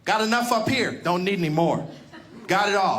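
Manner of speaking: normal talking voice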